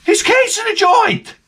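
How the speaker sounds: incredulous. Real, not acted. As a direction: repeat it climbing into outrage.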